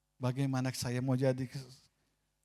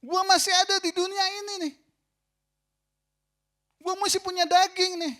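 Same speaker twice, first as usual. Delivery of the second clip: shouted much higher and louder, defiant; defiant retort; 14 semitones higher, 11 dB louder than usual. Retold or imitated. Pastor re-enacting his own outburst, shouted high and loud. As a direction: hurl it back like a stubborn outburst. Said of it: "Ini pendeta nih masih ngomong gitu".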